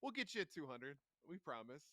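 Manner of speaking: normal talking voice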